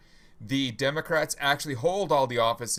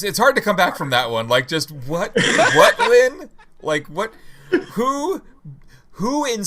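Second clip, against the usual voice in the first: incredulous laughing outburst; 6 semitones higher, 9 dB louder than usual. Real, not acted.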